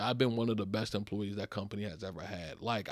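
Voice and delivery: ordinary talk, nothing marked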